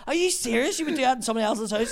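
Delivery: loud, high, indignant questioning; imitating her scandalised outburst; appalled disbelief. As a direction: fire it back in disbelief. Woman's appalled reply in quarrel.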